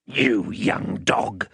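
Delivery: slow, drawn-out gloating menace; menacing man. Acted. Man licking his lips at the child, menacing. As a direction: relish each word slowly, like a hungry brute.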